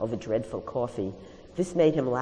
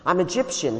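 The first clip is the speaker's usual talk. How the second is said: raised, louder declaration; shout; 5 semitones higher, 7 dB louder than usual. A narrator's reading. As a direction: shout it back across the street. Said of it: "he shouted".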